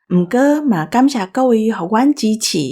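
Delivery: ordinary talk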